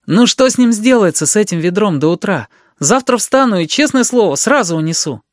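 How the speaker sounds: put-on high, eager boy's pleading; as persuasive as possible. A narrator's reading. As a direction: talk the aunt into waiting. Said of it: "Как можно убедительнее Серёжа сказал". (как можно убедительнее, высоким голосом)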